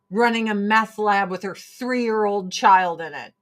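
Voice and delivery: ordinary talk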